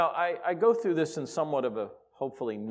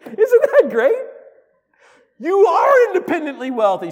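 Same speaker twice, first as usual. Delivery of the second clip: delighted; 15 dB louder than usual. Real, not acted. Preacher delighted by the punchline.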